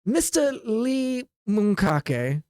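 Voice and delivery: slow, drawn-out Mister Lee Monkake, sneered with a rise; insulting tone. Acted. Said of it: "He says it like if he's, like, insulting you".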